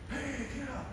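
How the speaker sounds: high, very soft voice of a dying dad; weak old dad, proud but fading; frail father. Retold or imitated. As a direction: give the sick father's faint pride. Father calling son back.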